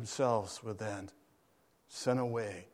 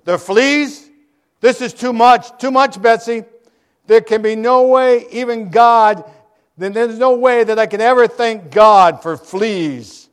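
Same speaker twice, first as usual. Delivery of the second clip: much louder, higher indignant outburst; outraged protest; 8 semitones higher, 19 dB louder than usual. A narrator's reading. Corrie loudly refuses to thank God for fleas. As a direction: refuse it with full exasperation.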